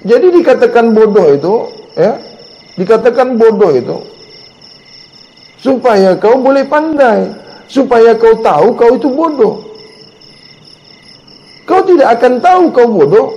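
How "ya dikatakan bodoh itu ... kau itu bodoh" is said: usual speaking voice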